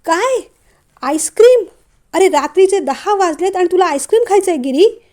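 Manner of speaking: high, loud disbelief; loud exclamation. A narrator's reading. Mother shocked by son's late-night ice cream plan, loud outburst. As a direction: burst out incredulous, voice jumping up. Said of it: "नूतनताई मग जोरात म्हणाल्या".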